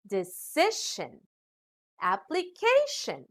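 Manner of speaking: "decision, application" with the weight on CI and CA; stress before ending. Shown as a demonstration. Teacher showing rule four, "decision, application" stressed before the final syllable. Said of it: "the syllable before these endings".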